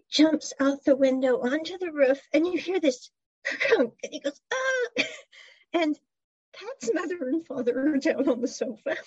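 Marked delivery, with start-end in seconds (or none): 4.5-5.0 high startled yelp; short yelp. Retold or imitated. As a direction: yelp as if you fell onto a roof. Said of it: "and scream".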